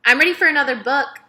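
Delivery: loud, high and fast, pushy; impatient demand. Acted. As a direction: snap it out like a bored, huffy girl. Amanda slams book, impatient.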